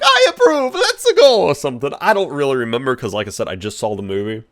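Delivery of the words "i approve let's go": very high, loud cartoon cheer; Jumpman. Jumpman approving the brothers' commercial, high loud cartoon voice. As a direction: shout it high like an excited game mascot. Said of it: "Jumpman's like".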